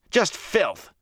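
high burst of revulsion; vehement disgust. Acted. Rand insists to silent watchers, in vehement disgust. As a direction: stress it with revulsion. Rand defends himself, revolted.

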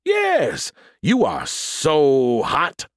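very slow, swooping fake Southern drawl; hammed-up Southern come-on, words stretched out; awful Southern accent. A narrator's reading. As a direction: flirt outrageously as a Southern pastor. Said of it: "putting on my awful Southern accent".

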